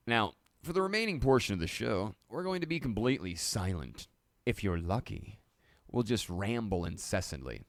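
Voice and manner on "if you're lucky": normal talking voice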